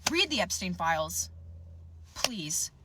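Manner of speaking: low, soft pleading; quiet plea. Real, not acted. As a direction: beg them quietly. Pleading quietly after pause.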